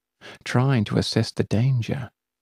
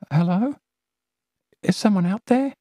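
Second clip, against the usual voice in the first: higher timid call; frightened girl; 10 semitones higher, faster than usual. Acted. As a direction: call into the dark. Celia frightened.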